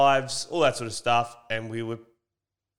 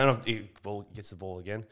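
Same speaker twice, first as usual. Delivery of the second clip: ordinary talk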